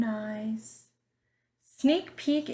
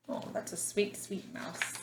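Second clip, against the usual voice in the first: whisper; 8 dB quieter, faster than usual. Real, not acted. Teacher's aside to children.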